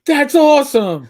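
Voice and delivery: high, loud, delighted with a laugh; excited child voice. Retold or imitated. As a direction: squeal it like a thrilled kid. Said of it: "niece's reply".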